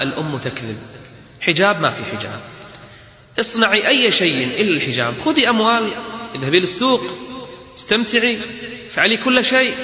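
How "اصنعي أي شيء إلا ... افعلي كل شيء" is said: high coaxing mother's voice, pitched well up; mocking mother coaxing. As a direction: wheedle like a mother tempting her daughter. Mother coaxes daughter to drop hijab. (صوت أم متملقة بنبرة عالية)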